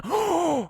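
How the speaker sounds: sharp high gasp; shocked gasp. Real, not acted. Gamer gasps as a villager slips off, high gasp. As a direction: gasp in horror.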